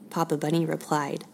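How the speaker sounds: ordinary delivery, nothing marked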